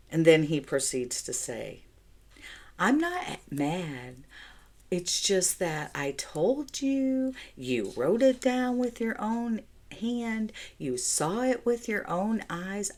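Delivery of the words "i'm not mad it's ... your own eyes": slow, higher, patronizing husband voice; imitating husband's pretend disappointment, slow; mock gaslighting husband. Husband teasing her over the phone, slow patronizing voice. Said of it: "in a gaslighting way".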